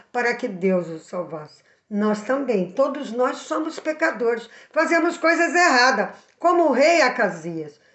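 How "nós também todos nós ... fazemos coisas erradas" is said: ordinary talk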